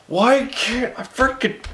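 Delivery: high, drawn-out exasperation; frustrated. Real, not acted. Reader annoyed she can't say a word. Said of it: "some words I just can't".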